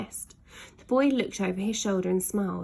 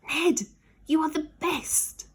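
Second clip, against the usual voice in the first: high, slow cheering shout; children shouting up; 9 semitones higher than usual. Acted. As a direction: yell it up to the passing dragon. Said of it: "they shouted up".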